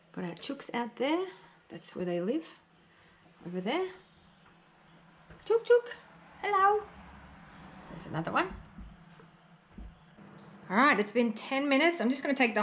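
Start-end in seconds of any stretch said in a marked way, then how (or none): 5.4-6.8 high sing-song chicken call. Real, not acted. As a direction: call the hens in high and bright. Calling the chickens again.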